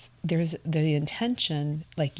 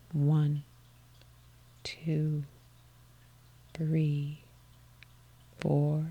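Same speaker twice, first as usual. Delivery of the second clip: guided breath count; much slower than usual. Acted. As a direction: count the breath gently, letting each number hang.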